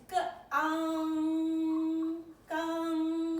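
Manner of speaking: "ge, ang, gang" sounded out, held high and level; flat tone on gang. Shown as a demonstration. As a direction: sustain "ang" and "gang" on one high note. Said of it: "That's flat tone".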